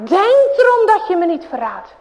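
grabbing them by the collar; storyteller's mock threat; threatening bully. A narrator's reading. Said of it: "Hij pakt de broertjes bij de jas".

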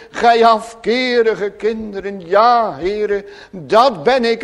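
loud, impassioned pulpit voice; fervent preaching. Acted. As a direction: preach it out with full conviction. Preacher in full flow. (vurig preken, luid en hartstochtelijk)

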